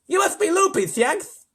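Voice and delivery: loud, high, exasperated lisp; indignant lisping leader; lisping President. A narrator's reading. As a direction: declare him crazy with a lisp. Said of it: "I'm reading with a lisp now".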